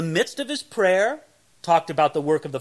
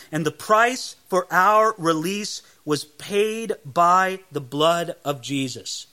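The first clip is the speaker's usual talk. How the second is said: ordinary delivery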